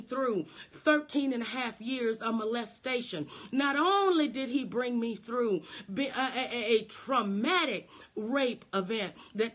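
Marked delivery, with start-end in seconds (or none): none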